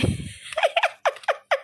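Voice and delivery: high unvoiced giggle; a squeaky breathless giggle; breathy high giggle. Real, not acted. Laughing at own looks. (cekikikan tanpa suara)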